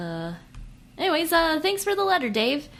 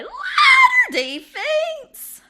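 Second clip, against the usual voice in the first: very high, loud, slow cry; horror shout; 15 dB louder, much slower than usual. Acted. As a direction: scream the attraction name. Shouted ad title.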